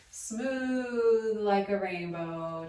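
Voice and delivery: very slow drawn-out "Smooth like a rainbow"; slow, stretched demonstration; smooth legato. Shown as a demonstration. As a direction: glide it slowly like a rainbow. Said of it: "mimicking smooth and choppy".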